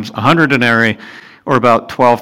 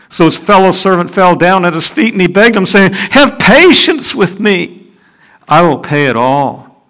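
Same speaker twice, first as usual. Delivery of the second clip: higher, louder, pleading reread; fervent plea in the reread; heightened scripture reading; 5 semitones higher, 4 dB louder than usual. Acted. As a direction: read it again with more urgency. Rereading verse, more emphatic.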